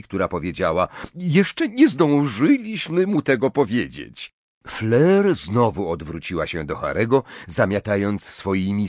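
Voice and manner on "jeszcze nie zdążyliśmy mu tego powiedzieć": higher, strained explanation; defensive mother. A narrator's reading. Mrs Weasley excuses herself to Fleur, defensive. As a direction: answer a reproach defensively, raised pitch. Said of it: "spojrzała z wyrzutem na panią Weasley".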